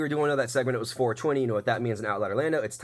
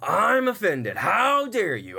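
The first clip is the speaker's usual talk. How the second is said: mock offended; 7 semitones higher, 3 dB louder, much slower than usual. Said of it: "Instead of like".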